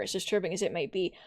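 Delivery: ordinary delivery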